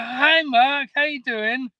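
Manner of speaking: high, loud phone hello; retold surprised greeting. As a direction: imitate her startled, high-pitched hello.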